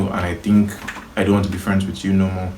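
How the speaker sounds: ordinary talk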